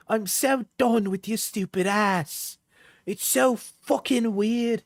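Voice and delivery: high, slow mock Cockney; attempted Cockney. Streamer reading out angry messages, hammed-up Cockney. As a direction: drag it out in a high, put-on Cockney. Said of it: "It's Cockney, I think".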